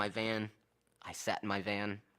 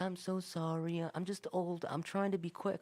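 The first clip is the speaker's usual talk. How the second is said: imitated apologetic old lady; 7 semitones higher, 3 dB quieter than usual. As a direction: pitch it up and apologise like an elderly customer. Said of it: "there was one lady".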